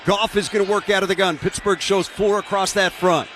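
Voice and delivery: radio play-by-play announcer. Real, not acted. Live play-by-play of the drive.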